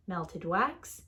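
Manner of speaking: plain reading voice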